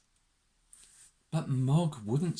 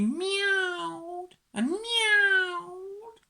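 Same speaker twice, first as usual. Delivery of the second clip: high, very slow cat wails; drawn-out meowing; 14 semitones higher, much slower than usual. A narrator's reading. Narrator voices Mog protesting in the car, wailing meows. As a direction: turn each word into a long meow.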